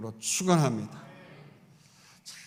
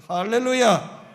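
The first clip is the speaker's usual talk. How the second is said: loud, high 할렐루야 with a falling end; full-voiced preacher's 할렐루야 shout; shouted hallelujah; 7 semitones higher, 11 dB louder, slower than usual. Real, not acted. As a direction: cry out 할렐루야 to the congregation. Preacher's exclamation.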